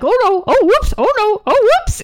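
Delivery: high, loud cartoonish fluster; mock panic. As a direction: imitate a bumbling pilot's oops. Retold clumsy pilot's panic.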